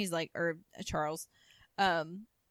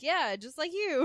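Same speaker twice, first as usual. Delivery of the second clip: gushing; 8 semitones higher, 7 dB louder than usual. Retold or imitated. Women gushing to Anthony.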